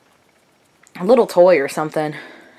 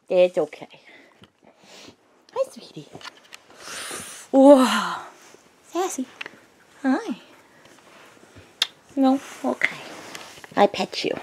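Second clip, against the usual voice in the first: soft pet talk; 4 semitones higher, 7 dB quieter than usual. Real, not acted. Soothing dog softly.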